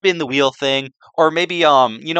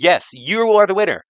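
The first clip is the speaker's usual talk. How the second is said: announcer voice; 4 semitones higher than usual. Retold or imitated. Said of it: "just to be like".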